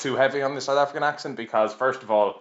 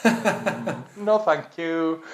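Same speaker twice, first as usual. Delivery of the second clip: higher, slow, prim no thank you; mock polite refusal; 6 semitones higher, much slower than usual. Turning down the town choir's invitation, in a higher, slow, mock-prim voice. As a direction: decline sweetly and slowly, tongue in cheek.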